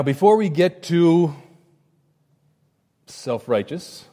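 ordinary talk

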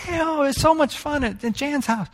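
high, breathy little sister gushing. Retold or imitated. [name] imitating his little sister gushing about a friend's house, high voice. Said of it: "and she's like".

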